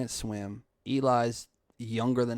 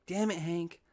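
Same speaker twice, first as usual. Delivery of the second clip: exasperated cussing; 6 semitones higher, slower than usual. Retold or imitated. Older brother cussing at Hank after rescuing him, exasperated. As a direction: curse at him in mild mock frustration. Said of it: "heard me cuss like that".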